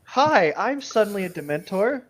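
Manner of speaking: high, loud chirpy hello; mock cheerful greeting. Pretending to be a freshly turned Dementor, chirpy. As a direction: play it as a perky new Dementor.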